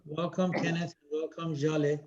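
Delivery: ordinary talk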